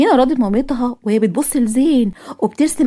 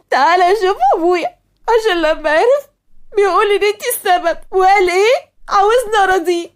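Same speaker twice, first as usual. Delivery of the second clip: upset complaining; 8 semitones higher than usual. Acted. Asmaa complaining to father.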